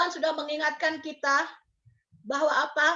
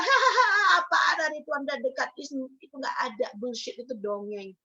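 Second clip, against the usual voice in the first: mocking laugh; 4 semitones lower than usual. Mockers laughing at the prophets.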